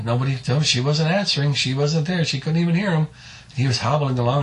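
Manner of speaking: ordinary delivery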